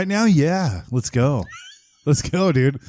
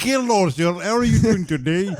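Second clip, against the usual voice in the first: put-on higher-pitched, convincing Russian accent; 6 semitones higher than usual. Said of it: "actually a very good Russian accent".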